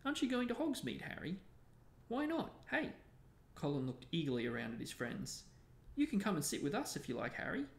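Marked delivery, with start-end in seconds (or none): 0.0-2.9 very high-pitched, excited questions; eager boy. A narrator's reading. Colin Creevey in awe of Harry, excited and high. 6.0-7.7 high, quick, keen invitation; eager boy. A narrator's reading. Colin inviting Harry to sit, excited high voice.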